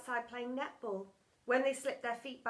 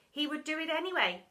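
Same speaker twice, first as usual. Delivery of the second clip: ordinary delivery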